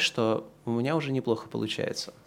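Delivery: ordinary delivery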